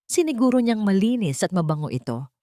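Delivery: ordinary delivery, nothing marked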